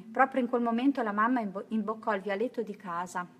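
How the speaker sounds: plain reading voice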